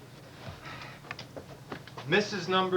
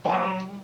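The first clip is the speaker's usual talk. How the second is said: mock bell bong; 4 semitones higher, 6 dB louder than usual. Imitating a church bell.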